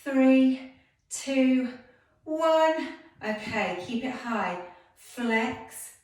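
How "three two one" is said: higher and louder through the burn; straining count. Real, not acted. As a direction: push through the last reps. Straining through final pulses.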